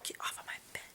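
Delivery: hushed whispered order. Real, not acted. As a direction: whisper it so the recording isn't disturbed.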